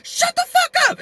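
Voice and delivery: high, loud imitated shriek of disbelief; shocked friend. Friend hearing the Dolce Gabbana news, shrieking in disbelief. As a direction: squeal it like a stunned girlfriend.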